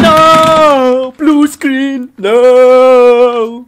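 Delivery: loud high shouting no; blue screen despair. Acted. Player facing a blue screen, loud high despairing shout.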